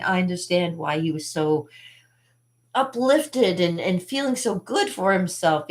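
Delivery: ordinary talk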